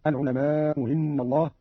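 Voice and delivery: "العلماء إن" with the hamza of "إن" eased, almost hinna; softened second hamza. Shown as a demonstration. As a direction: ease the glottal stop at the start of "إن". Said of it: "بتسهيل الهمزة الثانية".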